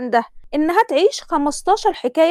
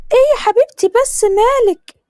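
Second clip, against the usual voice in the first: higher, louder pushy Egyptian mother; a mother hurrying her daughter, pitched up; Tasneem's mother; 10 semitones higher, 5 dB louder than usual. Acted. Mother voiced in story. (صوت أم مصرية عالي ومستعجل)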